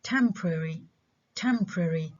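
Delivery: temporary squeezed into TEM-pruh-ree; reduced to three syllables. Shown as a demonstration. Teacher giving an example, temporary reduced to TEM-pruh-ree. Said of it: "نعمل له reduction ل three syllables".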